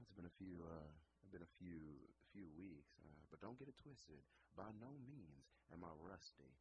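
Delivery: ordinary talk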